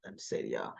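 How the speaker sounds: hushed retold whisper; a quick aside whispered under the breath. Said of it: "and she's like".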